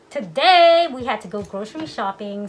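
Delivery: muffled by a face mask; through a face mask. Real, not acted. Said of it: "forgive the mask".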